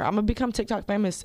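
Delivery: ordinary talk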